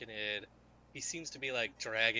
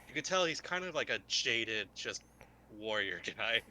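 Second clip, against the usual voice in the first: ordinary talk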